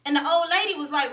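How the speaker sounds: ordinary talk, nothing marked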